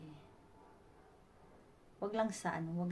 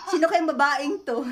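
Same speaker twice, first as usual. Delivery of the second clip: laughing mock indignation, higher and louder; imagined indignant thought; 5 semitones higher, 8 dB louder than usual. Prankster imagining ma'am's reaction to a stranger, laughing mock indignation. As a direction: voice her bafflement teasingly. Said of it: "siguro sa isip ni ma'am".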